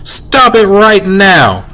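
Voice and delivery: high, drawn-out scolding; cross mom putting her foot down; mad mother. A narrator's reading. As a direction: scold firmly, stretching out each word. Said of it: "she was mad".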